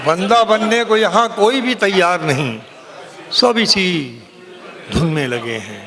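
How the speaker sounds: ringing, declaimed Urdu ghazal; poetry recitation. Acted. Poet reciting a ghazal to a cheering audience, declaimed. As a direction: declaim the verse in sweeping phrases, pausing for effect.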